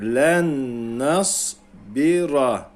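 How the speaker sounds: Len nas bira drawn out slowly; teacher repeating slowly; very slow recitation. Shown as a demonstration.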